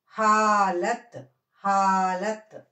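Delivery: slow, strong హాలత్ with long haa; emphatic teacher's model of హాలత్, bare final t; pronunciation demo. Shown as a demonstration. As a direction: stretch హా, then a short lat. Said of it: "హాలత్ అని పలకాలి".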